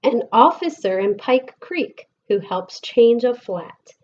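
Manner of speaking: lilting, sing-song picture book reading; gentle storytime voice with bouncing rhythm; reading to children. Acted. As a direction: read the rhymes aloud to a class of kids. Reads picture book to class.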